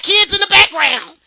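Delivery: loud, high-pitched mimicked complaint; mock outrage. Imitating men who object to kids in videos, mock outrage. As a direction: blurt it like a scandalized man.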